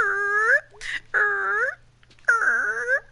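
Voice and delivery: high whiny fake cry "er"; mock wailing. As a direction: wail like a pouting kid. Mock crying noise.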